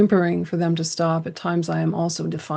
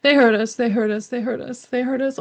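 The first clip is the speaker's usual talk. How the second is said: higher, tearful repeated plea; a little girl crying it over and over; mild sobbing child; 6 semitones higher than usual. Retold or imitated. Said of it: "And sobbing, she says".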